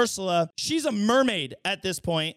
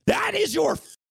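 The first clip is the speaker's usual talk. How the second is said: incredulous shout; 3 semitones higher than usual. Real, not acted. Ranting at the prince's reaction.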